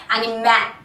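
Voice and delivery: "and in ma" said as "ani-maaal" with an open "ae"; stretched wrong ending. Shown as a demonstration. Teacher showing how not to say animal, stretched A. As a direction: stretch the last vowel of "and in ma" wide open. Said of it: "or stretching my mouth".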